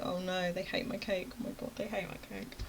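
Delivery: very soft, sinking voice; softly retold dread; hushed dismay. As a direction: murmur it in quiet dismay. Dismayed after room fell silent.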